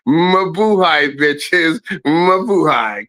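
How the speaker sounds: slow stretched Mabuhay; drawn-out sign-off. Real, not acted. Signing off to viewers with a slow stretched Mabuhay. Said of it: "sign-off catchphrase".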